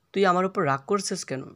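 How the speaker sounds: normal talking voice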